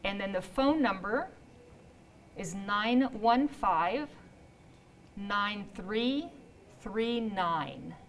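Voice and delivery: slow number dictation in small groups; deliberately slow. Real, not acted. Host giving the phone number, deliberately slow. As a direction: read out digits slowly, pausing between groups. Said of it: "I'm just saying it slow".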